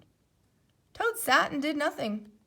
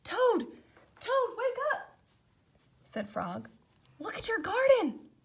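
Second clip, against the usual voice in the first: higher, excited wake-up call; 10 semitones higher than usual. A narrator's reading. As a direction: rouse a sleeping friend with good news. Frog waking Toad excitedly.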